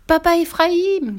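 high, loud, slow stretched cry; joyful shout. A narrator's reading. Fifi greeting her long-lost father, loud joyful shout. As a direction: fling the name out in pure joy. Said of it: "criait Fifi".

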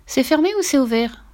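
high pleading question; pleading mother. A narrator's reading. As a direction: beg for the answer. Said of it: "supplia la mère". (mère suppliante)

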